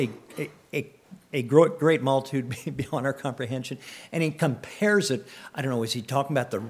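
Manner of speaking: normal talking voice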